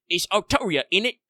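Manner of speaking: hammed-up British "innit"; British accent. Host joking about saying Artoria, mock British accent. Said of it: "My mother's British".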